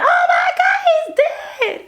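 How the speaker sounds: mock screaming. Imitating the girl who thought the actor was really dead, screaming in panic. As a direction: mimic a panicked woman's scream. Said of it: "she just started screaming and crying".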